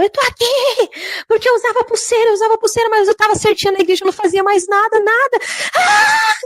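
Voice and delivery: high-pitched anguished scream, rushing into a wail. Retold or imitated. Young woman in hell crying out why she is there, screaming. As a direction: shriek it out like someone in torment, ending on a piercing cry. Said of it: "e ela gritou".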